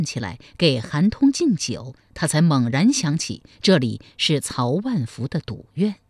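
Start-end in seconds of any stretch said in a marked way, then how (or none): none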